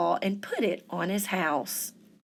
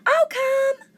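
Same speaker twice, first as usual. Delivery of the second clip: tiny anemone; 6 dB louder than usual. A narrator's reading. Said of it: "whispered a small sea an".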